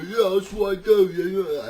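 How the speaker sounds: loud, high Cockney bluster; Cockney jailer. Acted. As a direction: brush it off as a rowdy Cockney. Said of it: "I don't know why they're Cockney".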